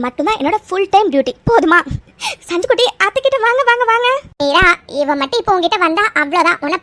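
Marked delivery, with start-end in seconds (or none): 2.5-4.1 put-on high, sing-song baby talk; coaxing a child. Aunt calling little child to her, cooing. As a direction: coax a little one into your arms.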